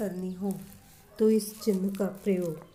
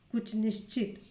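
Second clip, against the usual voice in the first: ordinary talk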